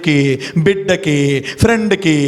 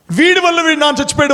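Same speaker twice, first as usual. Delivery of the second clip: high, fast accusing yell; elder shouting; 10 semitones higher, faster than usual. Retold or imitated. Elder shouting blame at the monk at the pyre. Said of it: "అని గట్టిగా అరిచాడు".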